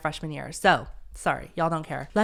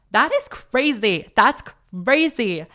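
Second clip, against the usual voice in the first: loud, slow and higher, stunned; amazed; 5 semitones higher, 8 dB louder, slower than usual. Real, not acted. Reacting to her old letter, loud slow amazement. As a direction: stretch it out in amazement.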